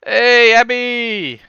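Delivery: loud drawn-out call. Real, not acted. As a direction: call her name across the house. Calling out to join call.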